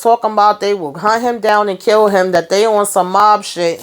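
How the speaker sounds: ordinary talk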